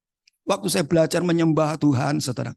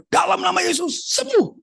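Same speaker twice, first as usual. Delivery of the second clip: high-pitched, forceful prayer command; re-enacted faith healer's cry; commanding healing prayer. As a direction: proclaim healing as an urgent order, voice high. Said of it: "Langsung saya berdoa".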